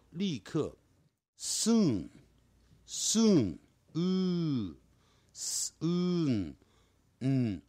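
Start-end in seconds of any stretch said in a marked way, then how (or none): 1.4-6.6 "soon" drawn out slowly with a long high falling "u"; drawn-out pronunciation drill. Shown as a demonstration. Teacher leading students in practice, slow model pronunciation. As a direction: sound out "soon" slowly, stretching the vowel. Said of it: "让我们一起来做练习".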